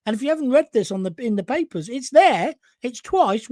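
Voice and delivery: normal talking voice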